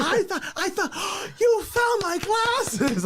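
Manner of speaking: very high, slow, breathless wonder; gasping mock delight. Comedian replaying the glasses game, very high gasping wonder. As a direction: gasp it out like a cartoon surprise. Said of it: "I'm like".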